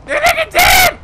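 high, very loud, stretched-out shout; hollering. Pretend. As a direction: holler the news to everyone around. Announcing the downed person is dead.

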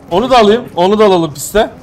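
ordinary talk

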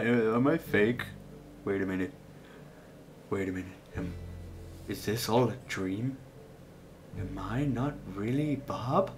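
mock crisis, murmured to himself; dawning doubt. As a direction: let the realisation sink in quietly. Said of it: "they ask you".

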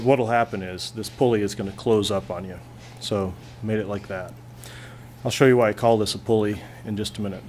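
normal talking voice

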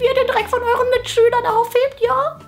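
high, smug teacher voice; a gleeful strict teacher lording it over the class. Acted. Teacher voice in sketch.

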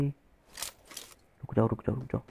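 drawn-out hushed wait; whisper, stretching the first stop; very soft wait wait. Real, not acted. Entering zone near enemies.

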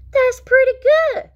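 very high, loud praise; squeaky, loud little character; impressed Froggy. Acted. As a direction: pipe up high, punching each word. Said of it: "said Froggy".

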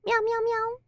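high little cat meows; playful meowing. Pretend. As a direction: meow along like a kitten. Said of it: "We're meowing".

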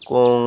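কুং ending in a held nasal ng; গুন্না nasal ending. Shown as a demonstration. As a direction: hum the ng of কুং through the nose. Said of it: "ইকফার সহিত পড়তে হবে". (কুং নাকে টেনে গুন্না করে)

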